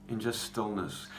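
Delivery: ordinary talk, nothing marked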